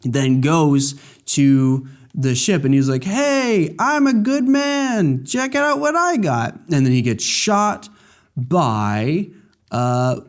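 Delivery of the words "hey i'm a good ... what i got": very high, slow smug put-on; hammed-up self-satisfied boast; smug mock voice. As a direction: preen like a sneaky traitor. Voicing a smug traitor.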